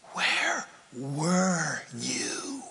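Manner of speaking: very slow hushed question; hurt whisper. Acted. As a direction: ask it wounded and soft. Hurt person asking God.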